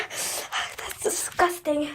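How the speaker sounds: slow, drawn-out whispered disgust. Real, not acted. Girl staring at her slime in the toilet, slow disgusted whisper. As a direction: whisper it like you can hardly look.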